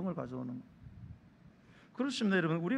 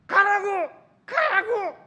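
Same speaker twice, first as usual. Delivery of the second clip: high, loud, separate bursts; angry girlfriend; 11 semitones higher, 11 dB louder, much slower than usual. Acted. Girlfriend after a big fight yelling at boyfriend, angry shouting. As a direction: yell him away in a fit of anger. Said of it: "여자가 막 화가 많이 났어요".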